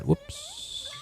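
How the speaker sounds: ordinary talk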